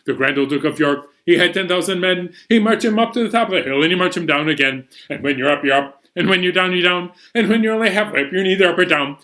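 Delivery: fast, rushed rhyme chant. Shown as a demonstration. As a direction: rattle the rhyme off quickly. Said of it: "Let's speed things up a little bit".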